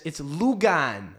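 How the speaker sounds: slow, "Lugan" said loo-gan; little girl says Lugan. Retold or imitated. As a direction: drag out "Lugan" like a proud child. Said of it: "eventually she says".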